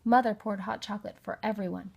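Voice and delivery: ordinary delivery, nothing marked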